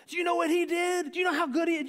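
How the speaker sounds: high-pitched proud boasting; bragging. Retold or imitated. Parent to spouse within the child's hearing, high bragging. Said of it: "Brag on them to your spouse".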